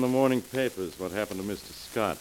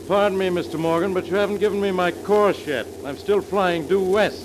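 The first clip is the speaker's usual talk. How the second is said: raised over engine noise; 7 semitones higher, 5 dB louder than usual. Acted. Pilot calling over plane noise.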